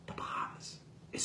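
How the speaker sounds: whispered The Bahamas, hushed in disbelief. Real, not acted. Reviewer disbelieving the shark's swim to the Bahamas, whispered. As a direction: breathe The Bahamas out with no voice.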